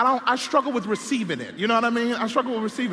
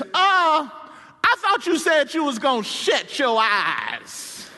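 slow, loud, higher mock-exasperated grandma; hammed-up scolding wife; irritated grandmother; 7 semitones higher, 5 dB louder, much slower than usual. Preacher voicing grandmother scolding granddaddy, loud mock scold. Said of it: "she says".

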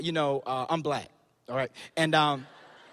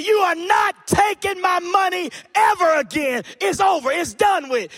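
defiant shout; 8 semitones higher, 3 dB louder than usual. Retold or imitated. Confronting the bully.

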